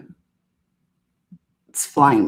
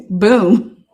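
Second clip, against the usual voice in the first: laughing boom; 2 semitones higher, 3 dB louder than usual. Retold or imitated. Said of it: "he's over here talking about".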